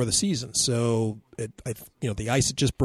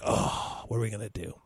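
dismayed hush; 3 semitones lower, 6 dB quieter than usual. Retold or imitated. Stranded after crashing the plane.

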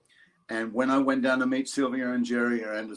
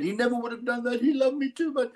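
much higher, mock wife's voice; wife's protest; 12 semitones higher than usual. Imitating the wife protesting to the court, much higher. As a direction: play the wife, voice jumped up high. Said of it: "His wife said".

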